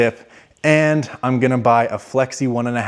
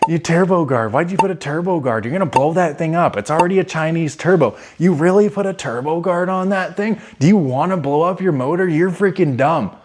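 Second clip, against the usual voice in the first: mock yelling; 6 semitones higher than usual. Commenters scolding him about the turbo, mock yelling. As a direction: imitate naggy commenters in a higher voice. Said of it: "everyone yells at me".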